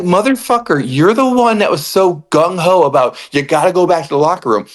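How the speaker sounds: high, exasperated yelling at the wrestler; fed-up outburst aimed at the pistol; mock angry rant. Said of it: "And I'm like".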